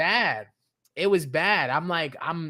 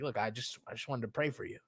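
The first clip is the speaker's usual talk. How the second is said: much softer, mock soothing; gentle and soft; 4 semitones lower, 10 dB quieter than usual. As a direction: soothe him, almost hushed. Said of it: "I was like".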